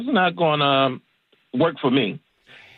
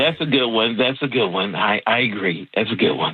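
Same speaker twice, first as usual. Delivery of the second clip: lowered, scratchy Jafar voice; scratchy villain voice; 7 semitones lower than usual. Pretend. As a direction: growl it like a villain. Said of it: "already trying the scratchiness".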